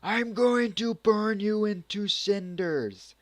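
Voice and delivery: high, loud, drawn-out threat; mock fury. Vlogger voicing the bather threatening the intruder, hammed-up angry yell. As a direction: yell it as an enraged anime girl. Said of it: "and she goes".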